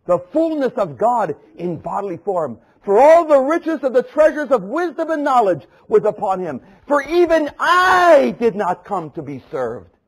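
high, loud, ringing preacher voicing Jesus; preaching at full force. Preacher thundering Jesus's claims to the congregation, high and loud. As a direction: proclaim it as Jesus, building to a shout.

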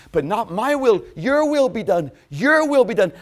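desperate prayer; anguished cry. Acted. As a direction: cry out in surrender.

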